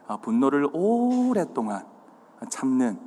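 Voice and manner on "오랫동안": 오랫동안 with 오 stretched very long; drawn-out emphasis. Shown as a demonstration. Preacher explaining slow anger, 오랫동안 drawn out. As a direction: stretch the first syllable of 오랫동안.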